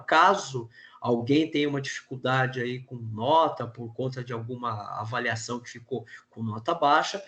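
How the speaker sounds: ordinary talk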